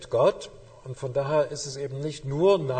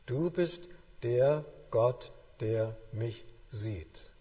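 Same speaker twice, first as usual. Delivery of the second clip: prayerful slow; slower than usual. Shown as a demonstration. Prayerful recitation of verse.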